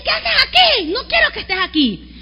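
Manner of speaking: sharp high scolding question; mild retold scolding. Mother scolding her son in the bathroom, sharp. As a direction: snap at him to get out. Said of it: "Yo le dije".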